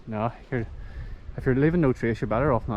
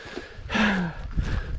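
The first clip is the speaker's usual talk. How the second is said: long breathless exhale; heavy sigh; 7 semitones higher, 6 dB quieter than usual. Real, not acted. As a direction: let out a tired, breathy sigh. After uphill walk, out of breath.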